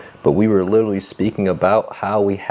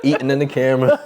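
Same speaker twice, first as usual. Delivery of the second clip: higher-pitched, laughing through the words; 6 semitones higher than usual. Real, not acted. Host joking with co-host about car podcasters, laughing as she talks. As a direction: crack up while mocking amateur podcasters.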